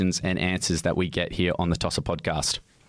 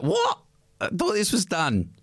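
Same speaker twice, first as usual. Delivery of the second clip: high-pitched mock disbelief; shocked outcry; 11 semitones higher than usual. Ambushed celebrity to the press, mock shocked. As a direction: yelp it as if caught off guard.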